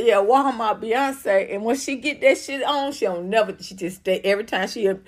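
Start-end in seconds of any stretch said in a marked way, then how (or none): 0.0-1.5 Walmart Beyonce drawn out very slow, Walmart stretched; savoring the nickname. Retold or imitated. Repeating a fan's nickname for the singer, slow and savoring. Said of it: "She said".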